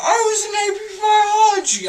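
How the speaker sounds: high, loud, fast wail; crying shout. Real, not acted. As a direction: wail it through tears.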